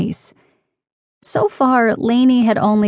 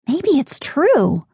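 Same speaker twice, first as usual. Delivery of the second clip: wondering thought; 6 semitones higher, slower than usual. A narrator's reading. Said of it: "Lainey thought".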